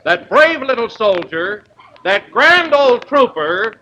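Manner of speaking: ordinary delivery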